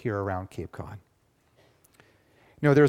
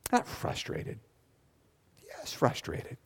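softer whispered self-correction; whispered aside; 4 semitones higher, 7 dB quieter, slower than usual. Real, not acted. Pastor whispering a correction to himself as an aside. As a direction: whisper it to yourself, half to the room.

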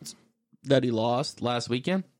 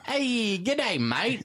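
put-on Australian accent; 10 semitones higher, much slower than usual. Mock Australian after Sherlock joke.

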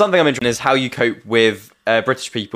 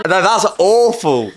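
high slow appalled groan; mock horror; 8 semitones higher, slower than usual. Real, not acted. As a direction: drag it out in disgust. Reacting to TikTok dances.

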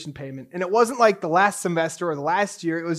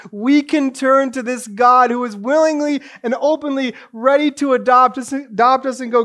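loud, raised sermon appeal; impassioned preaching; 6 semitones higher, 10 dB louder than usual. Acted. Preacher urging people to turn to God, loud and impassioned. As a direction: press the appeal home with force.

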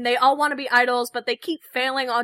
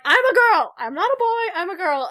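shouting; 7 semitones higher, 5 dB louder than usual. Retold or imitated. Girl yells she's not a boy.

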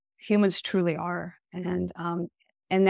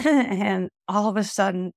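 ordinary delivery

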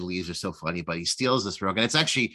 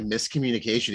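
ordinary delivery

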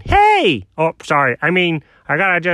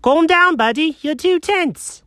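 high-pitched, patronizing doctor; condescending psychiatrist; 10 semitones higher, slower than usual. Acted. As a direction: soothe condescendingly, drawing out the last words. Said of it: "that seems a little condescending".

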